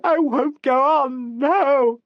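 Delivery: high, loud, slow screaming refusal; hysterical shouting. A narrator's reading. Actress screams she won't go on, high hysterical shouting. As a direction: scream it over and over like an automaton. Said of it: "they heard her shouting".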